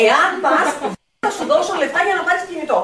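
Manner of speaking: mock a parent bribing the teen; bargaining parent. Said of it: "του τύπου, ας πούμε". (γονιός που παζαρεύει, μισογελώντας)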